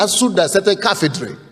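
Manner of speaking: ordinary talk, nothing marked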